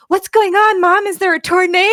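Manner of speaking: high, loud imitated child; eight-year-old son. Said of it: "my eight-year-old is in the back seat".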